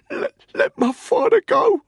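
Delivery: very high, halting, gasping plea; strained whisper. A narrator's reading. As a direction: beg for his father with failing breath. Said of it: "he whispered".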